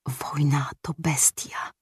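slow, hushed whisper. A narrator's reading. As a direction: breathe it out slowly, barely voiced. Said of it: "wyszeptał".

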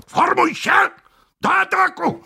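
high-pitched battle command; rally the troops to attack; officer calling out, pitch far up; called-out order. A narrator's reading. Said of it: "zawołał".

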